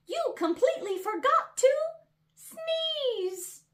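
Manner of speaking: tiny mouse teasing the big elephant; little mouse voice. A narrator's reading. Mouse laughs at elephant, high teasing voice.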